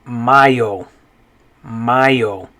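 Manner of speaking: mayo said MAH-yo, Spanish vowels; Spanish pronunciation. Shown as a demonstration. Teacher models the month for learners, Spanish pronunciation. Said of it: "the way you would pronounce this".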